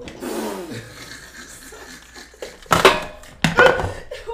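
high loud burst of laughter; loud cackle. Real, not acted.

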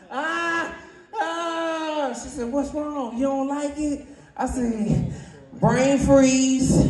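very high, soft strained squeals; high, soft pained voice; high squeaky yelp. Retold or imitated. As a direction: squeak like it's a shock. Reacting to surprise in story.

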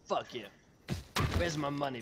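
ordinary delivery

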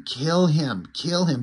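ordinary delivery, nothing marked

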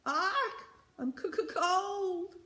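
very high, stuttering parrot squawk; screeching parrot. A narrator's reading. Green parrot moans about the cold, screeching. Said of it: "screeched the green parrot".

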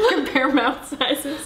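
laughing. Real, not acted. Laughing at mouth sizes.

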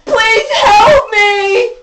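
high, slow fake crying; hammed-up crying plea for help; mock victim. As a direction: whine it like a fake sobbing victim. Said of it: "mocking the other woman's plea".

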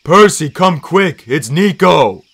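loud, high urgent call; big, alarmed shout; Tyson. A narrator's reading. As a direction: call for help, stretching the words. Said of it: "Tyson called".

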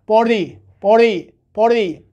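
"party" with the t softened to a d; "party" with a light tapped t; American flap T. Shown as a demonstration. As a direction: drill "party" as POR-dee, slowly. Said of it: "پارتی نه".

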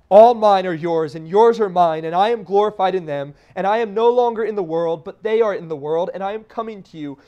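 loud fervent pulpit reading; preaching Christ's prayer. Acted. Preaches scripture at full force.

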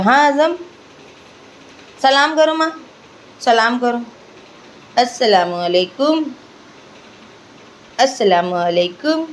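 doting mother cooing at her son. Real, not acted. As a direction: coax the little one to look at you, playful and doting. Teaching baby to greet.

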